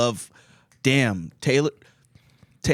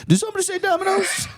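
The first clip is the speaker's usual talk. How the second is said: very high fast squeal; squealed ad line; faster than usual. Pretend. Voicing a pussy skit, squealing the Domino's slogan. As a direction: squeal it like a mocking commercial.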